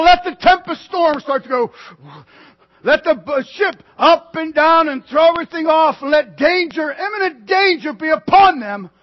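loud, high, driving sermon voice; fiery preaching. Acted. Preacher to congregation, stirring them with the storm, loud. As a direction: preach the storm with full force.

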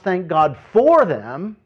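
"for" hit high and loud; stress on FOR. Shown as a demonstration. Preacher correcting a misreading, stress on "for". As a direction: punch the word "for". Contrasts FOR with IN.

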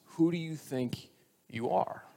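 soft, measured, with a pause; earnest question. Real, not acted. Preacher puts the last question to us, quietly earnest. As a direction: let it land quietly and sincerely.

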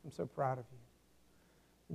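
father's quiet pride in his son, said slowly and softly; tender words of delight. Retold or imitated. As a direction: let it fade out tenderly, savouring each word. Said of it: "these words of delight".

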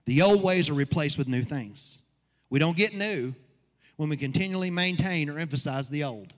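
preaching at full force. Acted. Pastor preaches to his church, driving the point home. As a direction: build the congregation up with every line.

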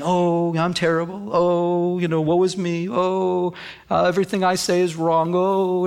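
mock woe-is-me moaning; hammed-up self-pity fishing for sympathy; attention-seeking woe. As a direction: wallow theatrically, stretching each "oh". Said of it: "you're wanting attention".

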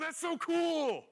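put-on high, slow, gushing kid voice; excited little kid. Paul as a kid watching the minnow swallowed, high and thrilled. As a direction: squeal it like a child at the fish tank. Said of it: "He's like a little kid going".

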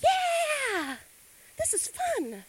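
put-on high, bright kid voice; a kid enjoying the grocery store; child's voice. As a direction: sound like a happy little kid. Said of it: "kids are like".

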